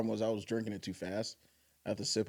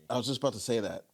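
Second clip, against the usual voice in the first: usual speaking voice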